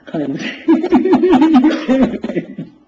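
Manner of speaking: high, loud, slow words dissolving into laughter; laughing. Real, not acted. Laughing at a man hiding under a cap, high laughter.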